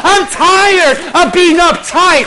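loud, high impassioned preaching; preaching at full force. Acted. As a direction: drive it home to the congregation.

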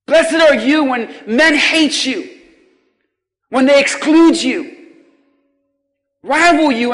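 loud, slow, soaring preacher voice; fervent pulpit preaching; preaching at full force. Acted. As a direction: proclaim each blessing to the hall. Preaching builds again.

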